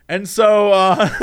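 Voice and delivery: normal talking voice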